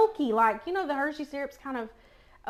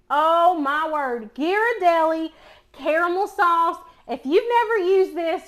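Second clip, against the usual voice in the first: excited; 5 semitones higher, 9 dB louder, slower than usual. Real, not acted. Excited about caramel sauce.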